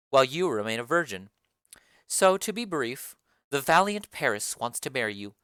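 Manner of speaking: low, commanding lady of the house; Lady Capulet. Acted. Lady Capulet calls the nurse for her daughter, low and commanding.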